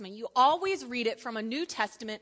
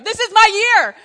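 higher, loud hammed-up cheer; mock hopeful declaration; 8 semitones higher, 14 dB louder than usual. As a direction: proclaim it like a New Year's vow. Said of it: "Every year I wake up".

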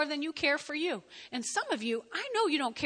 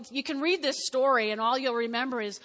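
ordinary delivery

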